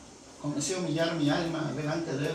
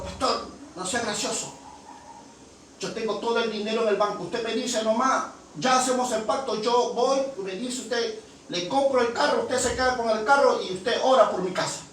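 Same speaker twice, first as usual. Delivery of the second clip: high-pitched, rapid-fire acted pitch; eager, pushy woman's voice; insistent bargaining; 6 semitones higher than usual. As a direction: press the deal without letting him object. Acts out woman insisting on deal.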